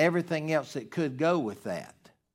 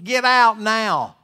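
urgent warning; 7 semitones higher, 9 dB louder than usual. Retold or imitated. Pastor urging an abused wife to leave, loud and urgent. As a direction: order her out of danger. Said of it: "I've always said to a woman".

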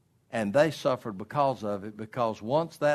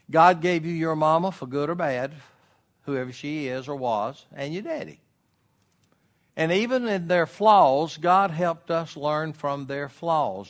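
preaching; 5 semitones higher, 7 dB louder than usual. Acted. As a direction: preach it out to the congregation. Preaching at full force.

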